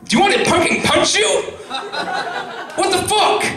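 incredulous shout. Retold or imitated. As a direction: blow up at the absurd request. Exasperated retort in bed story.

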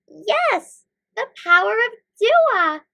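high, loud, triumphant; excited exclamation. A narrator's reading. Both heroes seize on the idea, exclaiming. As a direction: exclaim it with delighted certainty. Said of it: "Mikael and Malaika exclaimed".

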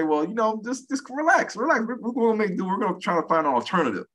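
high, gentle reassuring voice; soothing husband. Retold or imitated. Husband soothing his homesick wife, high reassuring voice.

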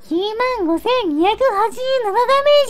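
ordinary delivery

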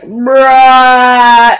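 one word held out loudly, level pitch; long loud bruh. Retold or imitated. Kid replying to the pizza guy, long loud bruh. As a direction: drag it out as a loud mock comeback. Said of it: "And then he's like".

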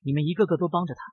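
ordinary delivery

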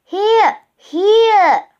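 high, loud repeated call; high insistent calling. A narrator's reading. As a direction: holler so you can be found. Girl calls from above to brother.

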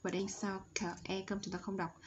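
usual speaking voice

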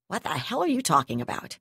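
plain reading voice

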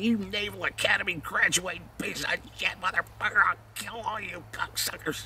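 high-pitched furious tirade, fading to a hiss; retold rage. Keith tearing into the lieutenant, high furious rant.

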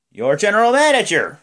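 high, loud hammed-up announcement; Vince. Boss naming the general manager, big announcer yell. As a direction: bellow it like a showman boss. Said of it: "And Vince is like".

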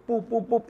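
high, quick "pup" bursts; imitated popping noise. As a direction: punch out high little pops. Imitating the song taking off.

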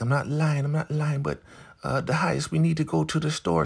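squeaky Marva dodging the question, rushing the last bit; high mock Marva voice. Host imitating Marva wriggling out of trouble, high and hurried. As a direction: pitch way up, hurrying into the store request. Marva denies, changes subject.

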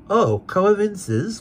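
a high, drawn-out cry of the name; slow, high cry of Coavinses; cried out. Acted. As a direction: sing out the name slowly. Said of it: "cried Mister Skimpole".

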